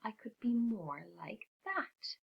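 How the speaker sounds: soft, slow wishing; soft slow longing; wistful hush. A narrator's reading. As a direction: sigh it out as a quiet wish. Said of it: "thought Mouse".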